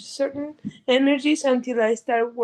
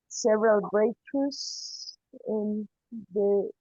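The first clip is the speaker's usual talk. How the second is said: usual speaking voice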